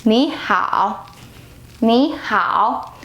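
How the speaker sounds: 你好 with 你 rising and 好 dipping low; second then third tone. Shown as a demonstration. Teacher models the changed tones, rising then low. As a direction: lift the first syllable of 你好, then drop into a dip. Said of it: "classic example of this tone changing".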